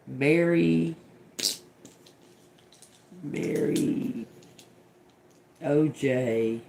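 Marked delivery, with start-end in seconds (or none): none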